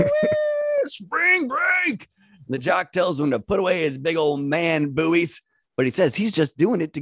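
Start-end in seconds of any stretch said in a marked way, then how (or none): 0.0-2.1 high, whooping party yell; hillbilly shout. Acted. Voices hillbilly character shouting.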